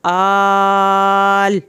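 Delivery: आल with its long a drawn out very slowly; teacher showing the drawn-out madd in आल; stretched vowel. Shown as a demonstration. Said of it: "अलिफ खड़ी ज़बर मद".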